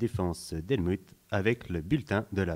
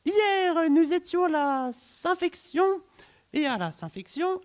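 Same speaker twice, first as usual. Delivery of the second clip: very high, loud chipmunk weather host; squeaky cartoon voice; 5 dB louder than usual. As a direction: chirp the forecast like a cartoon. Weather report, squeaky character. (présentatrice météo suraiguë de dessin animé)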